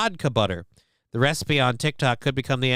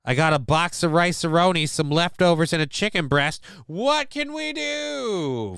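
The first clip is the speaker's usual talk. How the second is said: hammed-up excitement; 5 semitones higher than usual. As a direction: build to a long high-pitched 'do'. Mimics excited MacGyver-style cook.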